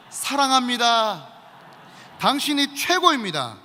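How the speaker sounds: slow, loud declarations to the person beside; loud group confession. Shown as a demonstration. Pastor leading the church in a loud spoken confession. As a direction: call it out boldly to your neighbour. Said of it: "자, 이렇게 한번 고백하겠습니다".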